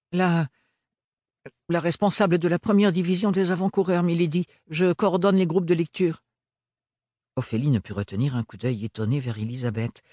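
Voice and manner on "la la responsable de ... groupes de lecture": shaken voice. Acted. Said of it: "une perturbation dans sa voix".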